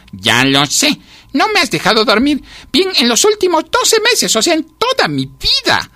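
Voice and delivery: very high, squawking rant; exasperated scolding. A narrator's reading. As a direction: berate a noisy neighbour at rising pitch. Ant berates the cicada.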